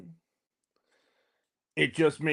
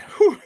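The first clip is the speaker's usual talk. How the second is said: loud high whew; 7 dB louder than usual. Real, not acted. Winded after stairs.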